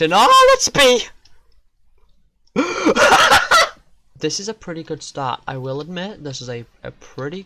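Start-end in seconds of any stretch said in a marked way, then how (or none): 0.0-1.0 sudden high, loud panicked shout; startled yell. Real, not acted. 2.5-3.7 loud, high-pitched burst of laughter; high startled laugh. Real, not acted. Loud outburst after a scare.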